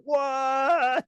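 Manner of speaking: high drawn-out what; group astonishment. Retold or imitated. Fans hearing it was the spa guy, stretched-out surprise. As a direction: stretch it, baffled. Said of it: "they were all like".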